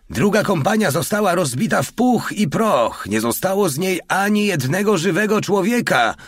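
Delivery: very high, strained outcry; frantic yelling. A narrator's reading. Sergeant reports his company wiped out, frantic yelling. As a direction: wail the disaster report at the top of the range. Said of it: "darł się do starego jakiś Feldwebel".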